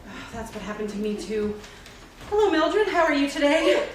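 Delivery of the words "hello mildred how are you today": loud greeting. Acted. Greeting Mildred in elevator.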